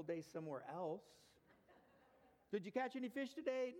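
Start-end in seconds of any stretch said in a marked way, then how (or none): 2.5-3.7 high, bright mock greeting asking about fish; cheery friend. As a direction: play a chummy questioner, pitched up. Acted question again.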